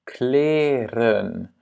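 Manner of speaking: Kleren drawn out, long first E. Shown as a demonstration. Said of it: "le premier E est long". (« Kleren » lentement, premier E long)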